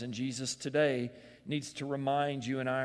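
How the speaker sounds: usual speaking voice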